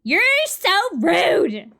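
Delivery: indignant. Acted. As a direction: draw out the outrage. Voices a character.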